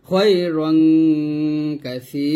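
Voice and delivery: রং drawn out one count before কাসি; one-alif stretch on র. Shown as a demonstration. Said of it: "রতে এক টান দিবেন".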